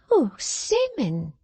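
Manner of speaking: high, slow simpering maid; high drawn-out simpering servant girl; Miggs simpering. A narrator's reading. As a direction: coo his name like a fawning maid. Miggs pleading.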